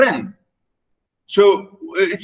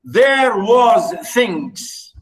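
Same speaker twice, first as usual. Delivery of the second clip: "There was things" stretched out very slowly, a bit higher; very slow reading of the quote; 4 semitones higher, much slower than usual. Shown as a demonstration. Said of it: "Again, he said".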